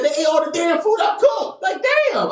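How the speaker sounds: put-on high, indignant girlfriend voice; high, upset mock-girlfriend; annoyed girlfriend.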